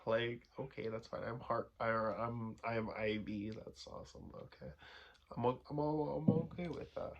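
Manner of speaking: tipsy mumbling. Real, not acted. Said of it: "I'm so drunk right now".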